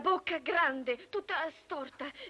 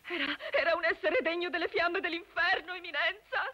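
distraught; 2 semitones higher than usual. Acted. Woman describing the devil to His Eminence, distraught. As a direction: sob out the horror of it.

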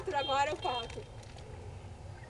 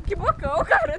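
excited; 4 semitones higher, 7 dB louder than usual. Real, not acted. Excited shout about big mouth.

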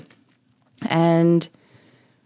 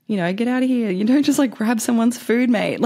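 higher-pitched mock indignation; indignant telling-off; 8 semitones higher than usual. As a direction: tell off a food thief, lightly acted. Imagined telling-off of food thief.

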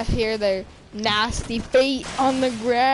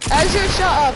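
annoyed shout; 6 semitones higher, 7 dB louder than usual. Real, not acted. Yelling at friend.

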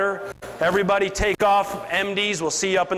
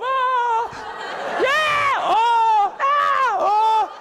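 emotional wreck; much slower than usual. Retold or imitated. Rookie whose client backed out, mock anguished shouting. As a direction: ham up a rookie falling apart over a lost deal. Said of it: "They're just an emotional wreck".

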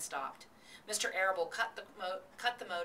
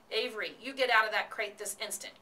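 commanding; 4 semitones higher, 5 dB louder than usual. A narrator's reading. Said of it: "commanded his mother".

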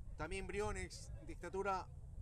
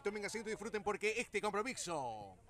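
normal talking voice

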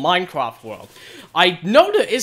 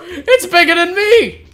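loud, high, startled exclaiming; alarmed amazement; 8 semitones higher, 8 dB louder than usual. Real, not acted. Gamer running into a huge hornet, alarmed amazement. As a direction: cry out, overwhelmed by what just appeared.

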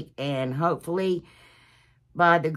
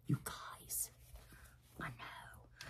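confiding whisper to the viewers; hushed unvoiced aside; 24 dB quieter, much slower than usual. Real, not acted. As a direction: let them in on it under your breath.

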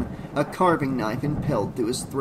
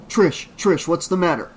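raised, urgent questioning; frantic concern; 4 semitones higher, 5 dB louder than usual. A narrator's reading. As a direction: frantically try to get through to her. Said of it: "Rob asked, frantically trying to calm her".

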